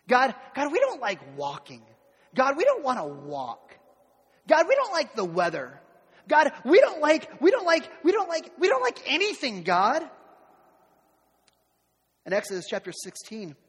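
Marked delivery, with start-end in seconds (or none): 0.1-10.1 loud, high mock whining complaint; escalating mock complaint, higher and louder; whiny grumbling. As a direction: whine at God like a spoilt child. Mocking grumbling Israelites to God.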